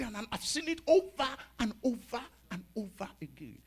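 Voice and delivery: ordinary delivery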